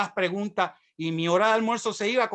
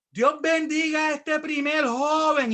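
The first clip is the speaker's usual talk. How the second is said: high, slow crusade preacher's proclamation; big drawn-out blessing; evangelist calling out; 9 semitones higher, 4 dB louder, slower than usual. Retold or imitated. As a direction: imitate a preacher announcing to a stadium. Imitates preacher on loudspeaker.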